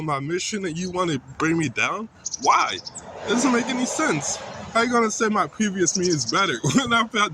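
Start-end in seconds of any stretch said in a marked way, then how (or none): 2.4-4.9 high, exasperated why; real exasperation at the family spilling out; frustrated outburst. Real, not acted. Son venting at his family over dropping out, exasperated and high. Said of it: "I know I'm getting kinda angry".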